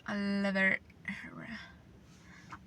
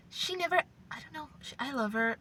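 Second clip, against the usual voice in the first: ordinary talk, nothing marked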